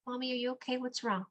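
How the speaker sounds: imitating her son gently checking on mom; mildly voiced worried little son; gentle little boy. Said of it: "real gentle, like".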